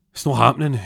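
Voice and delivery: high, loud outburst; acted-out angry denial; adamant refusal. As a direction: suddenly burst out in refusal. Bursts out in refusal.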